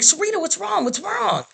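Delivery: high-pitched, acted-out worry; worried group reaction, voice jumping high; alarmed concern. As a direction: imitate friends fretting over someone in tears. Prison family reacts to her tears.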